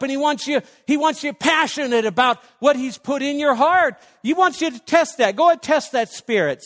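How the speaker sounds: loud, high, driving sermon voice; preaching at full force. Acted. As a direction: press the congregation hard.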